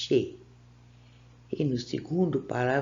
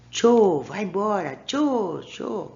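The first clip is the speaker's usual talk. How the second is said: playful shooing; 5 semitones higher, 5 dB louder, slower than usual. Pretend. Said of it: "Sabe o que significa esse cho aqui?".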